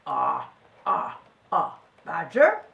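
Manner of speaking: low, halting disbelief; stammering surprise. A narrator's reading. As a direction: stumble into a startled question. Junk man startled by badger.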